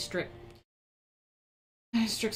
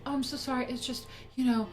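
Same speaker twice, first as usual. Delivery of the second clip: lightly higher, mock apologetic neighbor; mock neighbor excuse; 6 semitones higher than usual. Streamer mocking the neighbor's excuse for her screaming kids, mock apologetic. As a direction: mimic the neighbor's sorry excuses, a bit higher. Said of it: "I have to hear".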